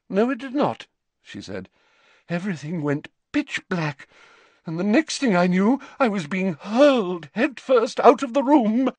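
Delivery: put-on an eccentric old prophetess recounting; dreamy seer. A narrator's reading. Trelawney voice.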